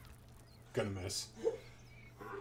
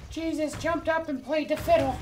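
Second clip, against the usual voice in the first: loud, high, hammed-up Irish accent; a jokey Irish accent shouted at the game; bad Irish accent; 9 dB louder than usual. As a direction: belt it out in a bad Irish brogue. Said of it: "That's a bad Irish accent".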